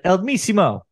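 fake Spanish. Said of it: "That's not even Spanish".

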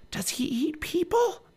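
high, slow, worried-question whisper. A narrator's reading. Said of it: "whispered Annie".

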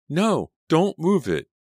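high, loud, slow panicked protest; panicked shout. Acted. Kid yells at others not to move the cabinet, high and loud. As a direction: yell to stop them.

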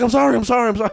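flustered apology. Acted. Boyfriend caught, apologizing.

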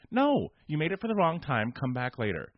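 high, loud mock refusal; exasperated rebuff. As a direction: shut down an arguing guest, exasperated. Acted rebuff of arguing guest.

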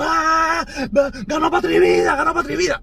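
high squealing mock cheer; mock celebration. Mocking Otaola's crowd celebrating, high mock cheer. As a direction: parody the critics cheering the win.

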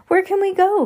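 high, loud panicked cry; cried out. Acted. Violet crying out to her siblings as cabinets fall, high and panicked. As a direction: shout it over the crashing cabinets. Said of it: "Violet cried".

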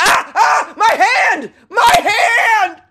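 high laughing shriek, half joking; hammed-up scream of pain; mock pained shriek. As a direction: yelp like the coyote just bit your hand. Stoner bitten by coyote.

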